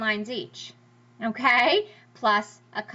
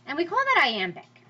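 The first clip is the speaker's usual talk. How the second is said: ordinary talk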